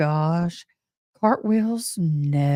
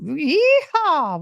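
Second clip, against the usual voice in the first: high mock cowboy whoop, much higher; mock Yeehaw; 9 semitones higher, 3 dB louder than usual. Mocking a Hollywood cowboy, a high playful yeehaw. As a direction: send up a Hollywood cowboy cheer.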